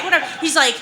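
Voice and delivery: ordinary talk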